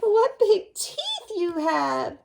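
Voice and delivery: high, loud, very slow wonder; amazed girl. Acted. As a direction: marvel out loud.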